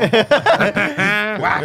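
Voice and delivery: laughing sound-effect whack; mock smack.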